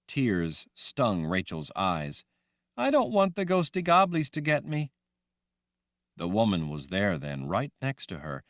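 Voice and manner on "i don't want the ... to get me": put-on high, loud young girl voice; childlike Rachel. Girl Rachel asking the woman, high and loud. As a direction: sound like a scared little girl.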